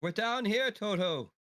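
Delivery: high, carrying in-character call; calling back. Acted. Calling to Toto from below deck, high call.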